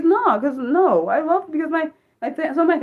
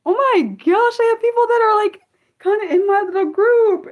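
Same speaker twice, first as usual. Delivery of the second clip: high, loud gush of joy; thrilled, voice jumping way up; delighted excitement; 13 semitones higher, 6 dB louder than usual. Real, not acted. Delighted to find similar people.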